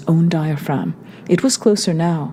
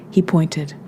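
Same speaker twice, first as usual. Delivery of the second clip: usual speaking voice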